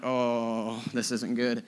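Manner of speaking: low, hushed dismay, imitating a wife; worried wife aside. Buddy's wife watching the confrontation, hushed dismay. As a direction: voice the wife's quiet sinking worry. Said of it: "my buddy's wife just going like".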